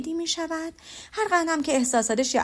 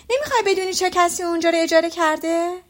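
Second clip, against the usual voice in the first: raised, loud and higher, pressing for an answer; loud impatient wife; 5 semitones higher, 5 dB louder than usual. Acted. Impatient wife pressing her silent husband, loud. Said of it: "و با صدای بلند گفت".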